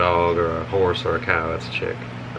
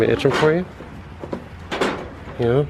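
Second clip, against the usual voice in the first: "Itch 'em for you" in a high sing-song pet voice; pet talk; 6 semitones higher than usual. Real, not acted. Owner scratching the goat, cooing in a high voice. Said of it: "Itch 'em for you".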